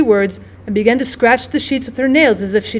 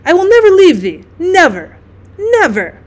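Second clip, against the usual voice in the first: put-on rising, louder childlike promise; passionate vow; 6 semitones higher, 4 dB louder than usual. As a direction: swear it with rising fervour.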